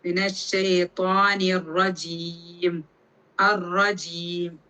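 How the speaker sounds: ഷെയ്ത്വാനി റജീം drawn out slowly in recitation; slow Quran recitation. Shown as a demonstration. Teacher recites the phrase slowly for tajweed practice. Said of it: "മീന ഉള്ളത് കൊണ്ടാണ് മീൻ".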